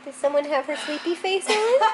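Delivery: high, sing-song pet voice. Real, not acted. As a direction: coo at the dog. Talking to the dog.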